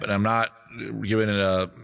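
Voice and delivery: ordinary talk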